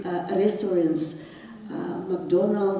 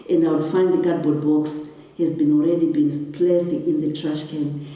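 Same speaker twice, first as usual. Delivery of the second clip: ordinary talk, nothing marked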